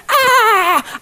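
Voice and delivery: mock panicked yell, high-pitched; hammed-up cry of alarm; panicked scream. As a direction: scream as if bitten. Acts out freaking out at snake.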